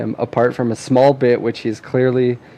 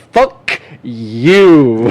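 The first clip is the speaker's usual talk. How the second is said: mock insult; 6 dB louder than usual. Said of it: "Patrick going".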